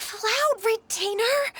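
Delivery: very high, slow, drawn-out mortified whine; shrill stretched "Cloud Retainer?" of pure embarrassment; embarrassed protest. Acted. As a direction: beg her to stop, dragging the name. Embarrassed by childhood stories.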